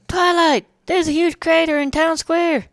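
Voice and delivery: high, loud tomboy call; excited shout. Acted. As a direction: yell it across town, full of energy. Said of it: "Rainbow Dash cried".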